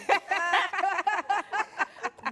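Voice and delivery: high, loud cackling laugh; loud burst of laughter. Real, not acted.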